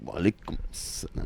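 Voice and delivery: very soft, trailing greeting; imitated faint, deflated reply; subdued reply. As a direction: answer under the breath, taken aback. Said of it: "he's like".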